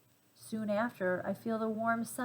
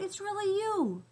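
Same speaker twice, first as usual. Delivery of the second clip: joyful shout; 12 semitones higher than usual. A narrator's reading. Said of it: "I shout".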